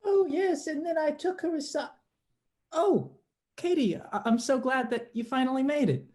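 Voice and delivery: high, airy pretend tea-party chatter; put-on high-pitched socialite; affected tea-party host.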